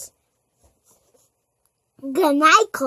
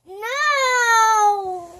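whiny no; 7 semitones higher than usual. Real, not acted. Toddler refuses bedtime.

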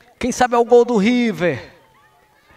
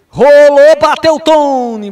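loud, higher shot call; excited shout; 4 semitones higher, 8 dB louder, slower than usual. Real, not acted. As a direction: yell the strike. Free kick struck, loud call.

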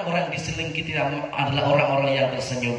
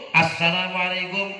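mosque announcer's salam. Retold or imitated. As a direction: mimic a death announcer's sung-out salam. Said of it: "Ngono iki salame gak cukup sepisan". (niru salam siaran lelayu dilagokake)

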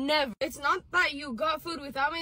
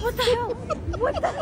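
shocked; 7 semitones higher, 6 dB louder than usual. Real, not acted. Sees her picture on screen.

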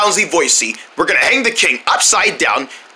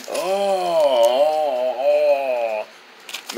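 low, soft moans dying away; contented moans; 5 semitones lower, 5 dB quieter, much slower than usual. Pretend. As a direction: moan lazily under the massage. King moans during throat massage.

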